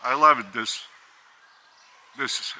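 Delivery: ordinary talk, nothing marked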